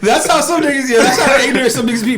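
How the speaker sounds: loud, high, laughing reaction; laughing hard. Real, not acted. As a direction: talk through big laughter. Friend cracking up at story.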